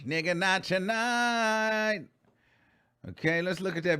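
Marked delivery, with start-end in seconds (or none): 0.1-2.1 even slower, drawn-out sassy no; sassy refusal again. Retold or imitated. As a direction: stretch every word, pure attitude. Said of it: "She said".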